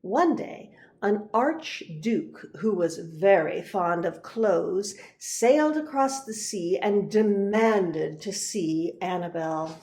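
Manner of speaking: plain reading voice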